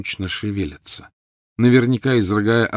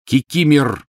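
raised, louder, sharp order; fierce command; 6 semitones higher, 7 dB louder, slower than usual. A narrator's reading. Master ordering the trembling elf, fierce. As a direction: call the servant to heel fiercely. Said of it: "свирепо произнёс Гарри".

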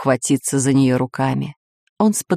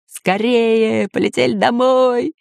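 higher, urgent pirate voice; pleading pirate; 5 semitones higher than usual. A narrator's reading. Pirate begs friends to fly home, pleading. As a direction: plead to get away, pitch raised. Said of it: "попросил пират Бульбуль".

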